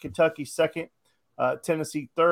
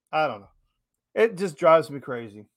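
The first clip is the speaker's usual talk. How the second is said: usual speaking voice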